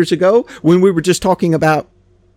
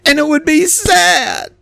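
mock sad; 10 semitones higher, slower than usual. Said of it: "be all emotional".

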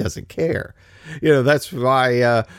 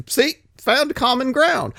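mock ADL; 11 semitones higher, slower than usual. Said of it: "they say".

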